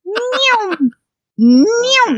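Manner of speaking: high-pitched drawn-out zooming noise; long high "neeyow" swoosh; imitated truck zoom. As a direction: mimic a vehicle whizzing past. Redoing her truck noise.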